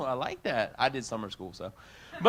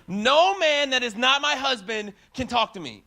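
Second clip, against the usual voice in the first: imitated angry yell; 5 semitones higher, 4 dB louder than usual. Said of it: "she's yelling and she's screaming".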